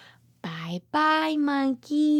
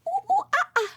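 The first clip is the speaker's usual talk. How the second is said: high, hooting monkey calls; monkey sounds; much faster than usual. Acted. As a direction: hoot it out like a playful monkey. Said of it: "this animal says".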